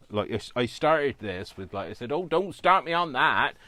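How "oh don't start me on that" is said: put-on loud, high West Country accent. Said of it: "West Country type accent".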